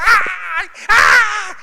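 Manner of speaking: high, loud terrified screams; screaming. Retold or imitated. Wife alone in the car screams at knocking on the door. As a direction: shriek as if a stranger knocks. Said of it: "la señora grita".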